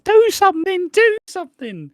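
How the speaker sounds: very high, loud imitation of frantic pleading; mock panicked shout. Bianca yelling as the baby is not moving, mock panic. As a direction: shriek it in hammed-up panic.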